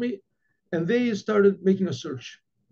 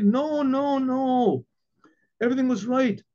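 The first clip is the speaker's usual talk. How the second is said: emphatic protest; 8 semitones higher than usual. Retold or imitated. Innkeeper assuring the rabbi nothing went wrong, emphatic protest. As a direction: protest insistently in a raised pitch.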